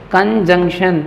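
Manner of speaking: कंजंक्शन as "kan-jung-shan"; correct pronunciation. Shown as a demonstration. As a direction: model the nasal in the middle of कंजंक्शन. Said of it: "क्या पढ़ेंगे इसे?". (कंजंक्शन, बीच में न के साथ)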